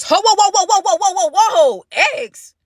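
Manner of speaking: high, loud, laughing whoas; stunned disbelief, cracking up; shocked whoa. Real, not acted. Shocked at the ex reveal.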